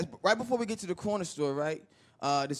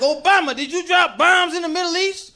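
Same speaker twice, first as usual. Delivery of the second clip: raised, pressing interviewer demand; loud questioning; 7 semitones higher, 9 dB louder than usual. Acted. Questioner confronting Obama about bombs, loud pressing question. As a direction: put the question loudly and higher.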